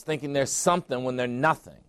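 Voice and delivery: ordinary talk, nothing marked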